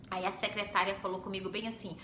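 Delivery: normal talking voice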